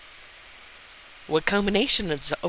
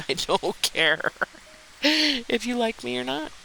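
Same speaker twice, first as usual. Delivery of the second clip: higher, laughing brush-off; laughing through it; 9 semitones higher than usual. Retold or imitated. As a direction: giggle through the words. Laughing while quoting.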